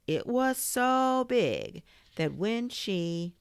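slow, drawn-out exaggeration; hammed-up bedtime tale; dramatic storytelling. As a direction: stretch it out high for effect. Boy tells exaggerated witch tale.

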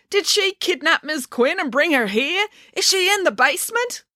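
put-on high, earnest boy's question. A narrator's reading. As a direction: voice the worried son. Boy voiced in story.

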